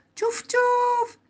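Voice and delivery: high choo-choo with drawn-out puff; train imitation. Boy hearing a distant train, high imitated chuffing. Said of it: "sentendo il treno da lontano".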